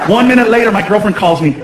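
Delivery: ordinary delivery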